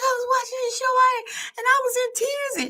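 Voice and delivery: high-pitched mock-tearful retelling; imitated near tears. Friend on the telephone about the show, imitated near tears. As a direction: imitate a friend welling up on the phone. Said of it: "she was about in tears on the telephone".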